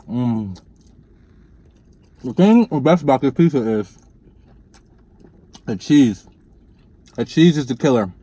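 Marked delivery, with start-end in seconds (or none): 0.1-6.3 talking while chewing; mouth full. Real, not acted. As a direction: cheese strands from mouth. Seen 0: Bites pizza, cheese strings hang from mouth while speaking throughout.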